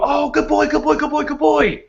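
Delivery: high, gushing praise for a dog; overexcited owner praising a lying-down dog. Retold or imitated. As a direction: coo it like praising a puppy. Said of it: "and you go".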